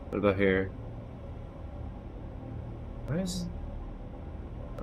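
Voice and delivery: ordinary talk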